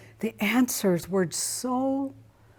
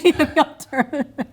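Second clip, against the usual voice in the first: laughing; 7 semitones higher, 4 dB louder than usual. Real, not acted. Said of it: "Versus the alternatives".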